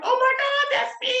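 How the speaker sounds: very high, loud delighted shriek; excited scream. Retold or imitated. Mother hearing the Harriet Tubman comparison, shrieking with joy, very high. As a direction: burst out in thrilled recognition. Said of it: "And she just screamed".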